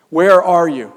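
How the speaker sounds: God calls loudly into the garden. Acted. God calling Adam out of hiding, loud and falling. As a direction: call it out loud with a falling end.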